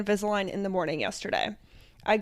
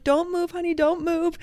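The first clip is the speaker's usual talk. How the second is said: higher-pitched coaxing, lightly put on; imitated coaxing doctor; 7 semitones higher than usual. Doctor to patient during filing, imitated coaxing plea. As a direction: coax a squirming patient to hold still.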